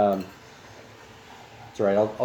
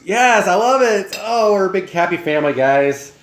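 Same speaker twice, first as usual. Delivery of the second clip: high, loud, slow joyful exclaim; delighted; 8 semitones higher, 5 dB louder than usual. Real, not acted. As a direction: gush with delight. Delighted at shared breakfast.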